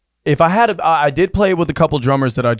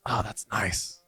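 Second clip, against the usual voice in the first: murmured absently, much softer; distracted mumble; 5 semitones lower, 15 dB quieter, slower than usual. Cousin on his phone, half-listening, muttering absently. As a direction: mumble it without looking up.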